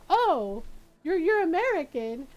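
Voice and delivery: high, loud retold realization; surprised policeman. Policeman realizing dad is American.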